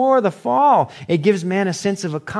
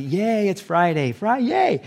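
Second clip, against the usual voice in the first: mock excited cheer; 6 semitones higher, slower than usual. Reader finally getting the Friday shirt joke, mock excited. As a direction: pitch climbing to a high drawn-out yay.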